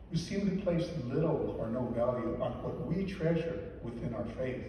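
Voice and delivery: ordinary talk, nothing marked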